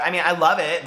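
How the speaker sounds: normal talking voice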